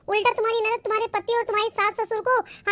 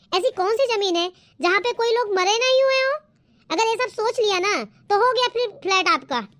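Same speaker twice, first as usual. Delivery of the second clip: normal talking voice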